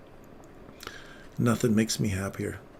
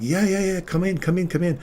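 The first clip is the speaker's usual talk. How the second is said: much higher, eager welcome; grateful mother inviting the paramedics in, high and eager; overjoyed mother; 8 semitones higher than usual. Retold or imitated. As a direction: welcome them in with joy. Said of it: "So her mother's like".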